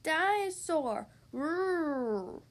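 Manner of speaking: slow, drawn-out toy dinosaur roar; toddler's one word. A narrator's reading. Little George saying his one word, slow toddler roar. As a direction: stretch it out like a little child. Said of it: "he could say one word".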